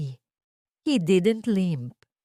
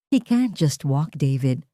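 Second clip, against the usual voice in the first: usual speaking voice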